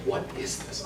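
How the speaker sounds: whispered baffled question; bewildered whisper. Retold or imitated. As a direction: whisper it like a puzzled teen. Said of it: "I was like".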